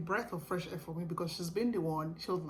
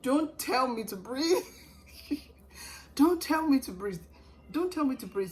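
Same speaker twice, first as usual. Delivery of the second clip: higher, loud exasperated yell; screaming at husband; 7 semitones higher, 8 dB louder than usual. Retold or imitated. Mid-labor to her husband coaching her breathing, loud exasperated yell. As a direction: snap it at him, loud and high. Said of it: "I screamed at some point".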